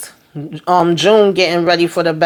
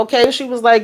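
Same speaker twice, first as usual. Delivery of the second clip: ordinary delivery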